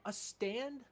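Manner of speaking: high, disbelieving echo; incredulous. Acted. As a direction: throw the word back in disbelief. Incredulous roommate in acted spat.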